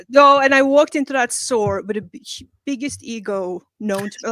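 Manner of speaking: ordinary delivery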